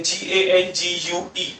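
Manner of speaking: usual speaking voice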